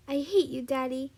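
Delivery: mild yell at a parent. A narrator's reading. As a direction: call it out angrily, held back. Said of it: "she yelled out".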